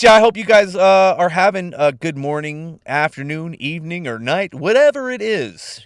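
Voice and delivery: playful radio host greeting, stretched words. Pretend. Host greets listeners for any time of day, radio voice. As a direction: switch it up into a radio announcer's voice. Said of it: "Got my radio voice going".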